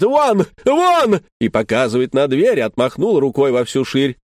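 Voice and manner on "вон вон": much higher, louder shout; wife screaming husband out the door. Retold or imitated. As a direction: throw him out with a shrill cry. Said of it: "как закричит".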